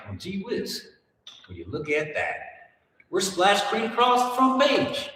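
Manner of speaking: high, put-on old-time Southern Clyde; excited Clyde in a 1930s Southern twang; 1930s Southern accent. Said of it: "anywhere close to their accents".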